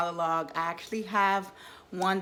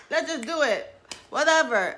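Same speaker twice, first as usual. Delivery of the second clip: higher, louder, tossed-off mock decision; careless impulsive quote; 7 semitones higher, 5 dB louder than usual. Women skipping a check with their bodies, mock carefree. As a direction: imitate someone brushing off all caution. Voices women acting without thinking.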